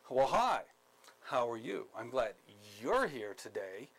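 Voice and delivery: fake-nice sarcastic hello. Shown as a demonstration. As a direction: sneer the welcome while sounding polite. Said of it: "a sarcastic tone".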